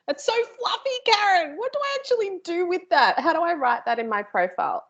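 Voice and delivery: high squeaky whine of a puzzled learner; mock frustrated attendee. Trainer mocks a confused attendee complaining to her, high whiny voice.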